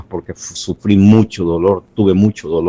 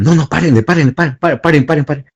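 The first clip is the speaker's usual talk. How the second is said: retold urgent call to stop; 7 semitones higher, faster than usual. Soldier stopping the torture.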